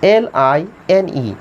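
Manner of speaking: slow, letter-by-letter dictation; measured dictation of each letter; spelling out letters. Shown as a demonstration. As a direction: spell it slowly for the class. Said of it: "discipline".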